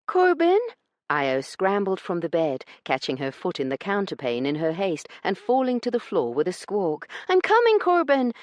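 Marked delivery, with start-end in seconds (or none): 0.0-0.6 hopeful call. A narrator's reading. Io hopes Corbin is there. 7.3-8.3 high, loud call across the room; excited shout. A narrator's reading. Io calls to the door, shouting excitedly. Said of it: "she shouted".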